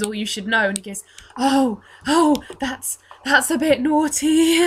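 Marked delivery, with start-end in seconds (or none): 1.4-4.6 put-on hesitant, innocent child, pitched up; [name]. Sheltered altar boy hearing of bad behaviour, high innocent dismay. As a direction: falter in a scandalised little boy's voice. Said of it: "child who has grown up being taught that innocence".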